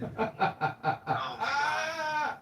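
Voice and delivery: real burst of laughter over the words. Real, not acted. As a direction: crack up mid-phrase. Laughing hard at the impression.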